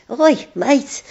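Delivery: put-on high-pitched Scouse kid calling over; mock Scouse schoolboy; Scouse accent. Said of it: "in a clearly a Scouse accent".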